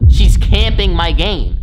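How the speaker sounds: loud slow outraged moan about his game; exasperated yell. Retold or imitated. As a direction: whine it out, drawn long and loud. Retelling the aunt taking his game.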